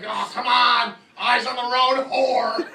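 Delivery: loud, high road-rage yell; furious yell. Retold or imitated. Driver yelling at a girl texting in the next lane, furious. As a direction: yell it angrily at another driver. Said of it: "I was so mad".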